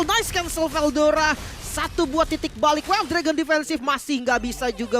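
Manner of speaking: loud, high esports call; excited play-by-play. Real, not acted. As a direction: hype up the clutch cancel. Live play-by-play. (komentar seru)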